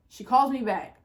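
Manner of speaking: ordinary delivery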